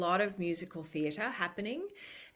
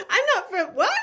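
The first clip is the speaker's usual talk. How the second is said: laughing disbelief; 7 dB louder than usual. Retold or imitated.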